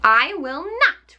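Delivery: a lightly voiced witch refusing; witch retorting. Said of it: "retorted the witch".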